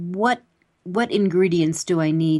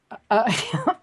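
laughing; 2 semitones higher than usual. Real, not acted. Host laughs at five days.

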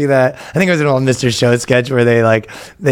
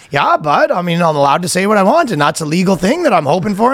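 higher-pitched mock Canadian; Canadian accent; 6 semitones higher than usual. Guy answers officers at his door, Canadian accent. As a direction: push back in a hammed-up Canadian accent. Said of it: "the most Canadian interaction".

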